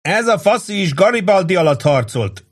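boisterous shout; shouting after. A narrator's reading. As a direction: yell it after him as he goes. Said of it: "kiabált utána Angelo".

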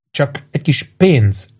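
a touch of put-on nerves, slow and tight; nervous. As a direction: make it sound nervous on purpose. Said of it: "hogy idegesen csengjen a hangja".

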